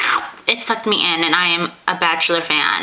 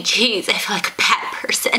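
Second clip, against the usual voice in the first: breathy guilty whisper. Real, not acted. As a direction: whisper it to yourself. Guilty aside.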